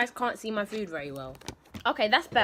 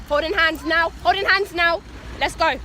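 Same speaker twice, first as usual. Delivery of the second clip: calling out; 8 semitones higher, 10 dB louder than usual. Real, not acted. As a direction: call it out, bright and high. Calling to child crossing.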